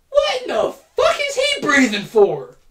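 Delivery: loud, high, outraged; furious "what in the fuck"; indignant outburst. Real, not acted. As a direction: shout it in disbelief.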